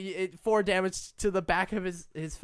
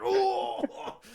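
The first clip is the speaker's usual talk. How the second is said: pained cry; 11 semitones higher than usual. Acted. Stunned troll cries out.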